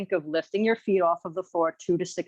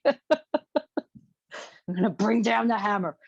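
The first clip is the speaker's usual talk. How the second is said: playful threat; much slower than usual. Pretend. Teasing about hard workout.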